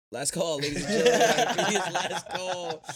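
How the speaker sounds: loud announcer call, laughing; loud last call. Retold or imitated. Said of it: "I'm like".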